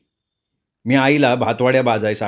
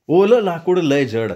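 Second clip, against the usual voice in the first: ordinary delivery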